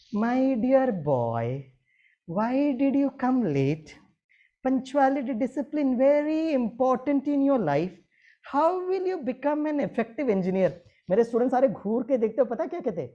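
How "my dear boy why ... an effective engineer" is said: yavaş, yüksek perdeden yumuşak öğüt; aynı azarı şefkatle, ağır ağır söyle; sevgiyle. Shown as a demonstration. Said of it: "अभी बड़े प्यार से बोलता हूँ".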